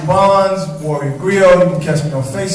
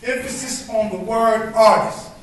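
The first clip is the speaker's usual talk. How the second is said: rhythmic spoken word performance, building and falling; spoken word poem; 5 semitones higher than usual. Acted. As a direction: perform it like a slam poem on an open mic stage.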